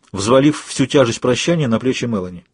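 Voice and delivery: usual speaking voice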